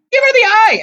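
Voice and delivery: very high, loud urgent scream. A narrator's reading. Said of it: "Annabeth screamed".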